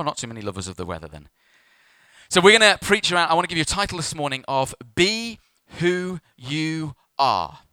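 ordinary delivery

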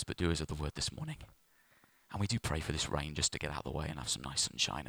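low, soft praying voice; prayer. Real, not acted. Pastor prays for the church this morning, prayer. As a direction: pray quietly, speaking to God.

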